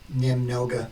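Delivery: немного said as "nim-NO-ga" for the app; careful Russian pronunciation. Shown as a demonstration. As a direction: pronounce немного clearly for the speech recognizer. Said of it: "I'm going to pronounce немного".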